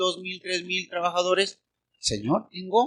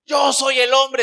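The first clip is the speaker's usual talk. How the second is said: shouted macho boast; 7 semitones higher, 9 dB louder, slower than usual. Pretend. Preacher mocking a macho husband at home, shouted boast. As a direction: bellow it like the proud man of the house.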